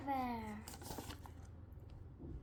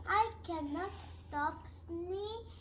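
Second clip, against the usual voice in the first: plain reading voice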